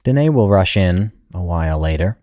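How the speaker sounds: ordinary delivery, nothing marked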